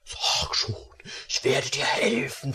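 breathy whispered coaxing; secret, tempting whisper. Acted. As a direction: whisper it close to her ear. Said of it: "flüsterte er".

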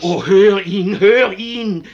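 laut flehend, beschwörend; beschwöre ihn laut, er soll zuhören; flehender Ruf. Acted. Seni fleht den Fürsten an. (Seni fleht den Fürsten laut an, auf Gordon zu hören)

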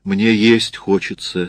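ordinary delivery

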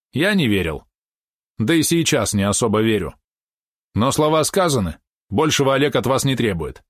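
lightly voiced young warrior; warrior's voice. A narrator's reading. Rozmich explains the plan. (голос дружинника)

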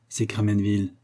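usual speaking voice

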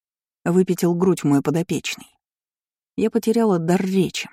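usual speaking voice

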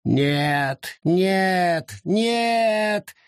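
rising, anguished no no no; groaning refusal. Acted. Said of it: "застонал лес".

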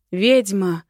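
loud accusing growl, briefly; angry growl. A narrator's reading. Dragon confronts the witch, loud growl. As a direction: growl it at an enemy. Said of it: "прорычал мой дракон".

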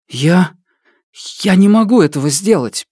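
put-on high, panicked voice of a desperate boy; frightened pleading. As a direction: beg in fear, voice climbing. Kaylin pleads with the shadow.

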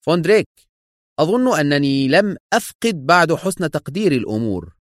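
high-pitched stern rebuke; cold reprimand; harsh and stern. A narrator's reading. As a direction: put the underling in place. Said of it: "في قسوة وخشونة".

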